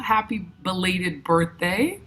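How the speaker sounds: ordinary talk, nothing marked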